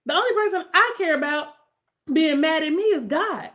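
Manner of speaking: loud, high, worked-up rant; real heated tirade; soapbox rant. Real, not acted. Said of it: "had to get off of that soapbox".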